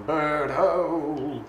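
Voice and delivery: mild mock lookout call; narrated yell. A narrator's reading. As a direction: echo a lookout's cry without raising the volume. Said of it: "He yells out to everyone".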